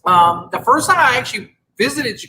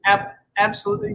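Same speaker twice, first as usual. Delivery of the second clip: ordinary talk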